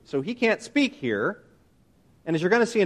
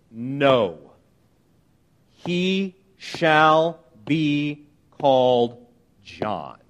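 each word set apart, very slow; slow, deliberate declaration; 5 semitones lower, much slower than usual. Retold or imitated. Preacher quotes the mother answering relatives, slow and deliberate. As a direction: say it slowly and solemnly, word by word.

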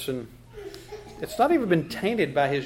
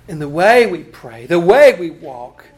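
fervent prayer; 13 dB louder than usual. Real, not acted. Closing prayer builds loud.

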